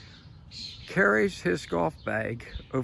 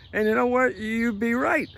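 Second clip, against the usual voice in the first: higher and louder; emphatic; 8 semitones higher, 6 dB louder than usual. Real, not acted. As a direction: punch it home with a grin. Emphatic punchline.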